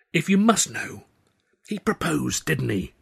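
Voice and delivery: a hint of an exasperated young woman confiding; Tilly's voice.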